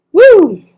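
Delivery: high, loud "woo!"; excited whoop. Real, not acted. Reader cheering a rocked boat, excited whoop. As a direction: let out a sudden celebratory whoop.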